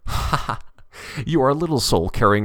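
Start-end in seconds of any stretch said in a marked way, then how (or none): 0.1-0.6 short breathy "ha ha"; brief acted laugh at a questioner; two puffs of scornful laughter; mocking laugh.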